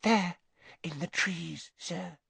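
small, high, halting elf voice; whispered. A narrator's reading. Elf confessing to Weasley, hushed and frightened. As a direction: let it fade timidly. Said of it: "she whispered".

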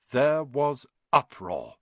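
ordinary delivery, nothing marked

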